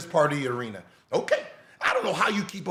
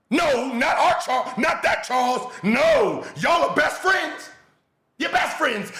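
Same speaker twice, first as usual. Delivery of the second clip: sarcastic shouted disbelief, much higher and louder; mock disbelief; 7 semitones higher, 3 dB louder than usual. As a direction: shout it like you can't believe it, full of sarcasm. Sarcastic mock disbelief about friends.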